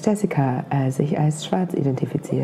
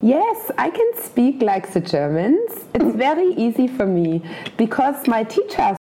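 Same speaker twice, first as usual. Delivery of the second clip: German accent in English; 2 semitones higher than usual. Shown as a demonstration. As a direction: speak English like a German teacher. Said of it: "so wie die Deutschen Englisch reden".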